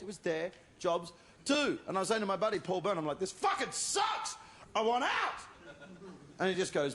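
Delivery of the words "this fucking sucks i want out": frustrated shout. Retold or imitated. To buddy Paul, shouting in frustration. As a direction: yell it like you've had enough.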